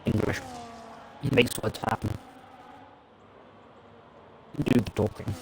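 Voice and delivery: speech through crackly mic. Real, not acted. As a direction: voice breaking up with crackle. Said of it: "the crackling is still happening for you".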